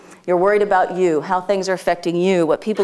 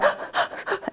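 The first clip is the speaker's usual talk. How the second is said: quick voiceless gasps mimicking a crying girl; soft, imitated crying gasps; imitated sobbing gasps; 8 dB quieter than usual. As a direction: act out catching breath between sobs. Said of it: "She was going".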